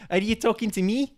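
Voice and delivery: high, bad Irish attempt, rising at the end; attempted Irish accent. Player repeating the quote for guessers, attempted Irish accent. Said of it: "has to be in the accent".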